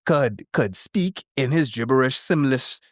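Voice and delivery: normal talking voice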